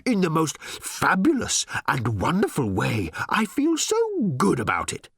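gushing, swooping high robot voice; squealing robot. A narrator's reading. Overjoyed robot raving about the change, squealing. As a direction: swoon with delight on the big words. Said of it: "squealed the little robot".

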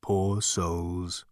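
lightly ethereal, low-key voice; a hint of disembodied mystery; deep ethereal voice. A narrator's reading. Voice in Zaphod's ear, deep and ethereal. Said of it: "said a deep, ethereal voice".

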